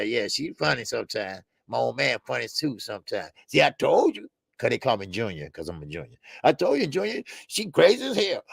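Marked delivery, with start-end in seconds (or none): 6.4-8.4 higher-pitched mock dad voice; playful high impression of a complaining father; imitating old dad. Voices his father complaining about mom.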